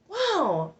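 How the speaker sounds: sudden high yelp; involuntary high squeal; high vocal tic yelp. Real, not acted. Talking about DCF, a sudden high tic yelp. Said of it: "I'm agitating my tics".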